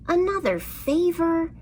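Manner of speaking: high, exasperated sigh of a question; a fed-up mouse asked for yet another favor; annoyed mouse. A narrator's reading. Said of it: "the mouse said with annoyance".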